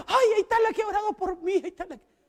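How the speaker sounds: high, fast and delighted, pointing her out; excited praise. Retold or imitated. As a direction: cry it out like a grateful healed woman. Healed woman praises her next day. (mujer sanada, emocionada y agradecida)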